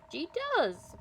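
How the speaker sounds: high, loud exclamation; excited high-pitched reply; eager outburst. Acted. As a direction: blurt it out eagerly. Character blurting an eager answer.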